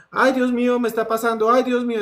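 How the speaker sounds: imitando un lamento agudo de niño; súplica asustada. Retold or imitated. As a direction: pide auxilio a Dios con voz aguda. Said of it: "y el niño". (lamento asustado de niño)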